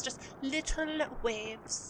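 slow, very soft soothing voice; soothing guide. Acted. Crewmate guiding the captain's meditation, softly and slowly. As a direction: lull him with it.

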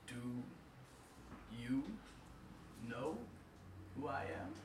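put-on slow, halting old man's voice; deep raspy old man. Death to Anansi, slow menacing old man. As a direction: chew on every word. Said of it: "spoke in a deep, raspy voice".

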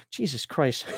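usual speaking voice

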